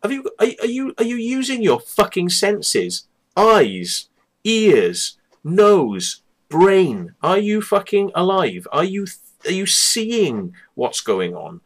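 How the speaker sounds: slow, disbelieving rant, counting off the senses; exasperated retort. Retold or imitated. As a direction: rant at her like she's not listening. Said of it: "and I'm like".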